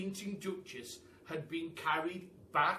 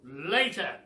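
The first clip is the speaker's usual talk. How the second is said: higher, loud, booming praise; old Duke praising the animals, loud and higher; 3 semitones higher, 11 dB louder than usual. A narrator's reading. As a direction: hail them like a grand old duke. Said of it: "said the Duke".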